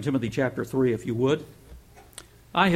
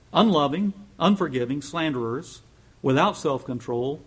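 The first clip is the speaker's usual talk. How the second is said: scripture reading; 2 semitones higher, 4 dB louder than usual. Acted. Reads scripture list aloud.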